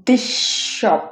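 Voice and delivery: this said "dish", running into shop; one long sh joining this and shop; assimilated S. Shown as a demonstration. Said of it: "উচ্চারণ হবে".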